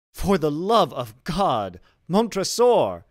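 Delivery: slow, loud, high plea, near screaming; desperate cry. Acted. Walled-in Fortunato begging Montresor for mercy. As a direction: beg for your life.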